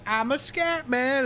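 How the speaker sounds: high, stretched comic impression; slow, high-pitched mock boast; excited movie quote. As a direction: imitate a film character crowing it. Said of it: "And he's like".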